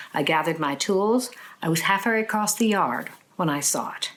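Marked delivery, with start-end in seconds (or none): none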